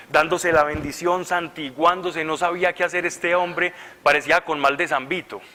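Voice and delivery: usual speaking voice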